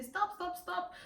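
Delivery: high-pitched, quick "stop" to a driver; high little cry of stop, stop, stop; urgent retold call.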